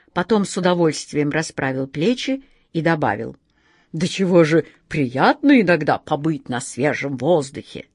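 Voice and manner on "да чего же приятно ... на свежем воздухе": довольный джинн. Acted.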